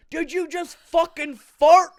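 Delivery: loud, high, slow mock-outraged drunk; drunk friend's accusing yell. Drunk friend in the car yelling accusingly, loud and slow. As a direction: bellow it like a drunk buddy accusing. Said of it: "He's like".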